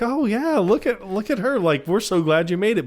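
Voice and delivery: high-pitched, delighted fussing; mock gushing welcome. Senator and wife greeting the fake mom, mock gushing. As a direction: imitate oblivious guests cooing over a newcomer.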